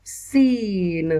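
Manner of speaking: सीन stretched very slowly, the long ee held; drawn-out seen. Shown as a demonstration. Teacher showing a pupil how to say सीन, drawn out slowly. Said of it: "यहाँ पर सीन का मखरज निकल रहा है".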